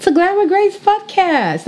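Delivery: very high, slow show name. Acted. Host announcing her podcast name very high.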